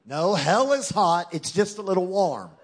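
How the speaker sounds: ordinary delivery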